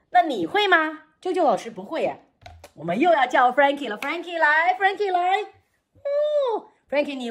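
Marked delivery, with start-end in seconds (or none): none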